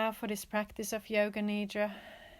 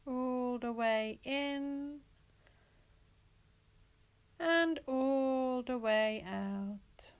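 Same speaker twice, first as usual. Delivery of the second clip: words stretched very slowly to match a breath; slow, lingering meditation drawl; breath-paced guidance; slower than usual. Real, not acted. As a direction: draw out each phrase like a long inhale and exhale. Pacing the listener's breathing.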